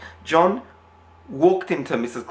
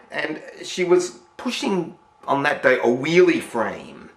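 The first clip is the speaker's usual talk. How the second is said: ordinary talk, nothing marked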